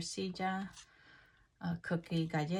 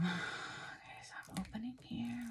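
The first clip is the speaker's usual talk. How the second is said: whisper; 4 semitones higher, 10 dB quieter than usual. Real, not acted. Narrating unboxing softly.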